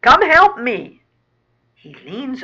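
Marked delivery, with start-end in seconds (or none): 0.0-1.0 loud, raised bellow. Acted. Fat rat calls his pals to help, bellowing. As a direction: shout for a hand, full volume. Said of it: "bellows a large fat one".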